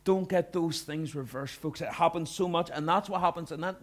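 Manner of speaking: ordinary talk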